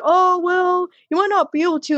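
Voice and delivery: mock doubtful. Voices doubters refusing her the wheel.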